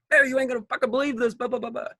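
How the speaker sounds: high, fast, gushing retold brag; imitating his own giddy phone call; excited bragging.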